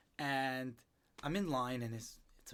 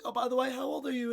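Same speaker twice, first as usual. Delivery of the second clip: imitating her; 11 semitones higher, 5 dB louder, faster than usual. Retelling the older woman's question.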